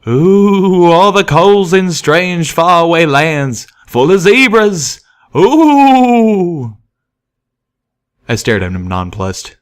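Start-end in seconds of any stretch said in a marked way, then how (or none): 0.1-6.8 high, loud, slow ghost-story wail; hammed-up spooky Ooh; mock spooky voice. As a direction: spook a friend for fun. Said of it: "making a mock spooky face".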